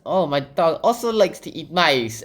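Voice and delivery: sweet and oblivious; Alice chatting to the mouse, high and sweet; cheerful girl voice. Retold or imitated. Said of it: "And she's like".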